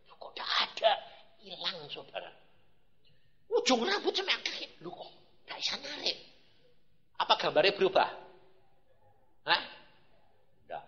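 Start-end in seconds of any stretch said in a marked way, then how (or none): none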